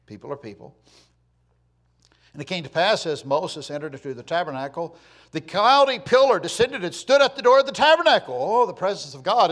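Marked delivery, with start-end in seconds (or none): 2.3-8.4 loud, high preaching declamation; forceful scripture reading. Acted. Preacher reads God's rebuke of Israel, forceful scripture reading. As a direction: thunder the verse out to the congregation.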